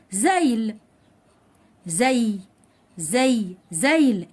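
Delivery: زيل with a distinct y glide, "zeyl"; زيل carefully enunciated so the y is heard; very clear y. Shown as a demonstration. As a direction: linger on the y in زي, then add the l. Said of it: "نطقت الياء بشكل واضح قوي".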